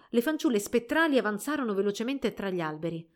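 ordinary delivery, nothing marked

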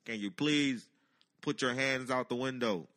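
loud, projected trooper's order; booming official command to a driver; loudspeaker announcement. Retold or imitated. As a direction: imitate a cop on a loudspeaker. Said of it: "gets on a loudspeaker".

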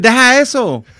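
loud, high Puerto Rican Spanish; Puerto Rican accent. Shown as a demonstration. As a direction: call it out sharply as a Puerto Rican. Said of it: "Puerto Rican be like".

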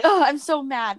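mock-annoyed complaint; retold mad friend. Retelling her nice friend's complaint about being mad, mildly exasperated. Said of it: "She doesn't get mad very often".

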